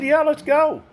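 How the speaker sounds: mimicking his wife's quick eager yes; imitating eager wife. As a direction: play the wife saying yes, cheerfully. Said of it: "She said".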